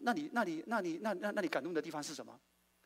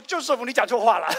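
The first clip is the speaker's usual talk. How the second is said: loud, higher scolding wife; hammed-up wife rebuke; 7 semitones higher, 14 dB louder than usual. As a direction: snap at him, you said it wrong.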